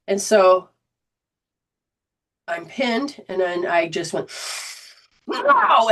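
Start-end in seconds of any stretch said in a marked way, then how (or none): 5.3-5.9 sudden high yell; re-enacted power yell. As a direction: burst out high, as if throwing someone off you. Re-enacts throwing the instructor off.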